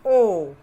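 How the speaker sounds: ओ said loud and clear as a long o; ओ, loud held o sound; clear vowel demo. Shown as a demonstration. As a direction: call out ओ for the class, pure long o. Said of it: "ये हो गया हमारा".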